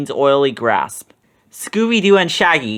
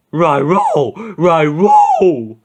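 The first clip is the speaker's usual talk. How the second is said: sobbing Scooby; 2 semitones higher, 5 dB louder, much slower than usual. A narrator's reading. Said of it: "Scooby was sobbing".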